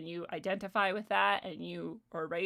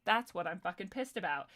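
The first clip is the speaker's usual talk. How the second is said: ordinary talk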